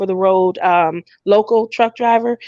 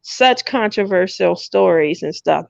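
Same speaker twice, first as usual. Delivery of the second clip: normal talking voice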